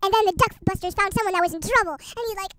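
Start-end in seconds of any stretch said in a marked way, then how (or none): none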